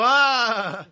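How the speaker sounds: grito de sobresalto imitado, más alto y más fuerte; grito de susto imitado. Retold or imitated. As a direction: imita el grito de la esposa sorprendida. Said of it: "ella pegó un grito". (grito de susto imitado, agudo y fuerte)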